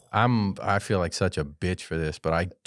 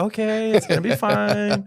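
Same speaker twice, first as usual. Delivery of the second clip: high, singsong mock calm; forced reassurance; 12 semitones higher than usual. Dad coming back to his son, forced reassurance. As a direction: reassure in a strained high pitch. Acts composed return to room.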